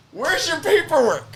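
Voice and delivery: very high-pitched imitation of a judge; incredulous high retold question; mock judge question.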